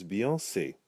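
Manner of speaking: "Beyonce" ending in a long A, like "say"; "Beyonce" as bee-on-SAY; open final vowel. Shown as a demonstration. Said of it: "it opens it up to A".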